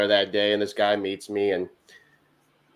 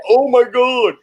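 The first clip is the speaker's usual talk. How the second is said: high, louder mock shock; shocked women; 11 semitones higher, 4 dB louder than usual. Driver imitating women hearing curses from the van, shocked. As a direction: imitate scandalized women, pitched way up. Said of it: "these women are just like".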